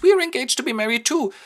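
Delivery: put-on high and fast gushing girl; hammed-up high excited chirp; mock girlish announcement. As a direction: blurt it out like a giddy fiancée. Announces the engagement.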